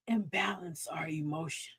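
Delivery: soft whispered advice. Acted. Host coaching calm, whispered. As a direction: breathe it out softly.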